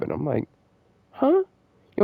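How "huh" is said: high, exaggerated confused huh; baffled huh. Student baffled by a long homework question, high confused huh. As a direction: squeak it out in total confusion.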